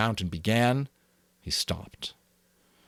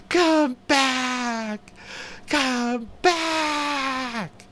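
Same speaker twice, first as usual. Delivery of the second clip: very high, loud frantic scream; insane scream; 4 dB louder, much slower than usual. A narrator's reading. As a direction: scream out of control. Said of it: "His voice rose insanely".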